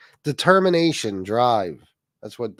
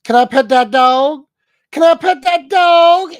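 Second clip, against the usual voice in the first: put-on Southern accent; 12 semitones higher, 10 dB louder than usual. Streamer imitating a little girl at the zoo, Southern accent. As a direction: mimic an excited tiny Southern girl, louder and higher each time. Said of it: "like, this Southern accent".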